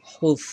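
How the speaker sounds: "হুস" ending in a th sound; tongue-to-teeth Tha. Shown as a demonstration. Teacher showing the letter Tha, "হুস" lisped as huth. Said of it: "জিহ্বাটা উপরের দাঁতের সাথে লাগাবেন".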